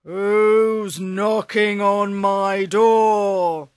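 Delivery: a slow, loud giant at the door; ogre. Acted. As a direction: drag it out like a big grumpy ogre.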